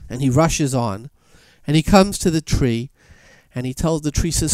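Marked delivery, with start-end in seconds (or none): none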